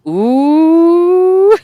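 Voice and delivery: loud, high, drawn-out "ooooh"; rising schoolyard tease, long and loud; teasing "ooh". Pretend. Teasing the writer's secret visit.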